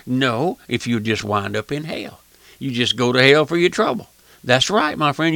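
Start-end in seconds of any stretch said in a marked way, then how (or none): none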